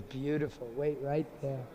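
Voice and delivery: low, soft aside; a friend softly setting up the trick; hushed instruction. Acted. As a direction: keep it quiet so nobody hears. Lalita hushes the disguised one.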